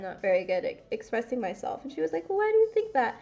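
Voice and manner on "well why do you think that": therapist's question. Retold or imitated. Therapist voiced asking question.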